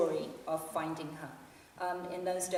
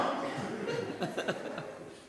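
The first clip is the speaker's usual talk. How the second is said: breathy laugh. Acted. Fan laughs at his story.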